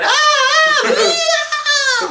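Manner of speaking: very high, loud mock wails of "Ah"; imitated anguished screams. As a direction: shriek "ah, ah, ah" sharply, like the actress on the floor. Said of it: "this woman going".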